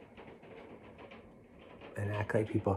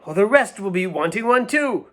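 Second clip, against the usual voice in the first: high, louder waiter hushing a diner; a fussy waiter begging him not to shout; waiter's voice; 10 semitones higher, 3 dB louder than usual. Acted. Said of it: "Said the waiter".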